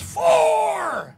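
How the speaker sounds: long, high, loud "fore!" holler; big high shout of warning; yelled golf warning. Acted. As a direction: holler it out across the fairway, drawn out. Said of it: "he yells".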